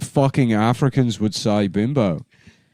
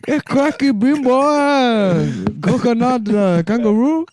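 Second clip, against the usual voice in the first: hammed-up mock accent, pitched way up; Australian African accent; 12 semitones higher than usual. A friend, clowning on request, doing his mock accent. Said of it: "Australian African".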